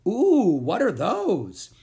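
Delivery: high, loud, slow ooh of wonder; curious creature. A narrator's reading. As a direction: marvel at the larvae like a tiny critter.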